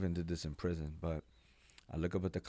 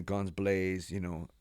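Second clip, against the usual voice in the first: ordinary talk, nothing marked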